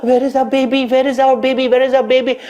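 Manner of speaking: high and loud, repeated panicked calls; terrified mothers searching; desperate where is our baby; frantic mothers. Acted.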